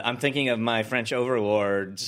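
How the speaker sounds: usual speaking voice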